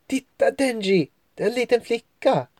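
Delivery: put-on high, quick girl's voice pointing something out; urgent little discovery; excited girl. Angie spots the girl.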